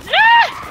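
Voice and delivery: high, loud shriek; alarmed scream. Real, not acted. Player rushed by enemies, high scream. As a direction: scream as they come at you.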